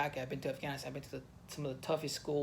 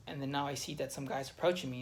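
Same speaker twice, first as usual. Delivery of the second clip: usual speaking voice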